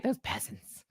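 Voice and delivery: whispered mock snobbery. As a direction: hiss it under breath. Mocking snobbish aside.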